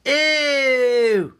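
high, loud disgusted "Ew"; disgusted crowd. Retold or imitated. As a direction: squeal it in disgust. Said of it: "And everyone went".

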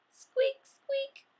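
very high little squeaks; mouse squeak. Acted. Classroom full of pet noises.

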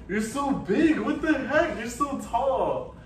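high, swooping coo; doting amazement. Real, not acted. Marvelling at son's growth.

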